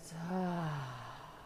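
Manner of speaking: long open-mouthed sigh; audible exhale. Shown as a demonstration. Teacher on the last shared breath, long audible exhale. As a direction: let all the air go in a long sigh. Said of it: "audible exhales together".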